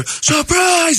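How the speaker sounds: high, drawn-out cry of surprise; high-pitched party yell; party surprise shout. Retold or imitated. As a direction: imitate guests springing a surprise.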